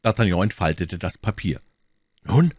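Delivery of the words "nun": high, loud, eager question; ask, bursting to know; eager curiosity. A narrator's reading. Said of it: "fragten seine Freunde voll Neugier".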